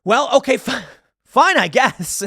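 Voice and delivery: high, loud grudging okay; half-hearted mock agreement; mock reluctant acceptance. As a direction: shrug it off in a high mocking voice. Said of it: "I'd be like".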